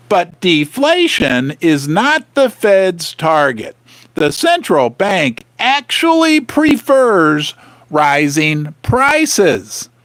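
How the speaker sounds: slow, every word weighted; slow emphatic reread. Shown as a demonstration. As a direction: read it slowly so it sinks in. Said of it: "listen to that paragraph one more time".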